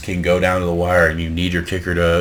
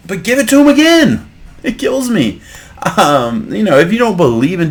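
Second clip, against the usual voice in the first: rising higher and louder into an exasperated near-yell; frustrated rant; 8 semitones higher, 3 dB louder than usual. Real, not acted. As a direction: vent at the coach, building to a shout. Ranting at the coach's play calling.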